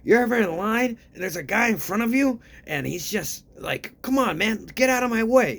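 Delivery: high, loud, put-on voice of a pampered star; mocking rich celebrity. Mocking how a rich celebrity talks to ordinary people, high and loud. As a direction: lampoon a clueless rich celebrity. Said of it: "When a rich-ass celebrity talks about".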